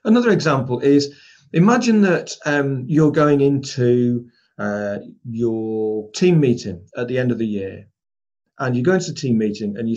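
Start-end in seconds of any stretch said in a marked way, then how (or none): none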